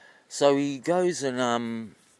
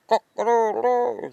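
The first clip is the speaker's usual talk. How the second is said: high, loud cock-a-doodle-doo crow; rooster crow; 10 semitones higher, 8 dB louder than usual. Acted. Rooster strutting in on the old man, crowing.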